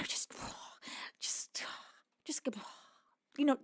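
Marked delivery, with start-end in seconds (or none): 0.0-3.4 sighing whisper of relief; relaxed sighing. Shown as a demonstration. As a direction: sigh out the words, whispered. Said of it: "the physical representation of relax".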